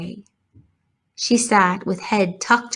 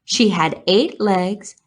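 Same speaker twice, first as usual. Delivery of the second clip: ordinary delivery, nothing marked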